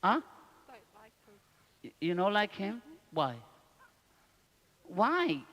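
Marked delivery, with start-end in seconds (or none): none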